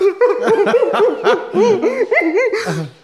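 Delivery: loud, high hammed-up wailing; mock bawling. Storyteller acting out his own breakdown, loud comic wail. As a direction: imitate helpless sobbing, laughing through it. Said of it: "I'm there, like".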